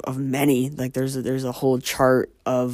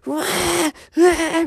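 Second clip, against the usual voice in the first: high-pitched imitated bawling; mock baby wail. Imitating Jessica freaking out at daycare, mock wailing. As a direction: cry "wah" like a freaked-out baby. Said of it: "bawling and crying".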